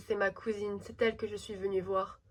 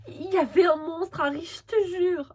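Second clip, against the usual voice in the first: panicked insisting; 7 semitones higher, 5 dB louder than usual. Acted. Panicked telling Harry.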